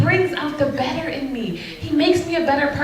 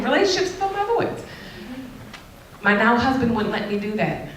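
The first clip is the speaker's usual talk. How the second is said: ordinary delivery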